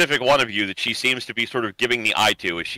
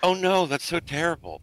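higher-pitched, lightly played concern; acted sympathy; 8 semitones higher than usual. Game master as Emily hears Iggy was unwell, acted sympathy.

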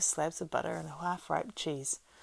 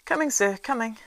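higher, louder, quick reply; eager servant; 7 semitones higher, 8 dB louder than usual. A narrator's reading. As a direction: call back from another room. Servant answers calling.

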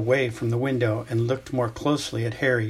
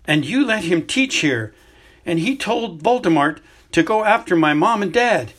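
put-on raised, accusing teen voice, louder and higher; he can't hold the anger in any more; angry outburst; 6 semitones higher, 5 dB louder than usual. Boy accuses headmaster of trusting a traitor, furious outburst. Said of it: "he could not stop himself".

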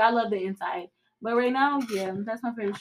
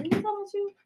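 mock surprise; 10 semitones higher, 3 dB quieter, faster than usual. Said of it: "I'm like".

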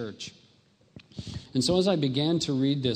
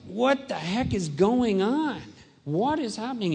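bewildered plea; 9 semitones higher than usual. Retold or imitated. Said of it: "So I was like".

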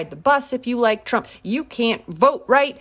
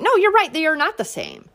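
sarcastic; 7 semitones higher than usual. Real, not acted. Sarcastic agreement.

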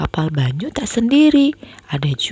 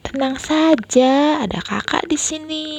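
comforting; 7 semitones higher than usual. Acted. Sister comforting brother.

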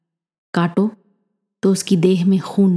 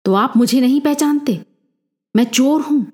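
higher-pitched indignant challenge, falling at the end; understated angry outburst, pitch raised; mild narrated roar; 6 semitones higher than usual. A narrator's reading. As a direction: flare up without really shouting. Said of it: "घूरते हुए गरज उठा वो".

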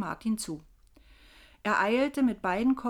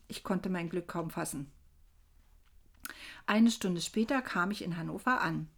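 plain reading voice

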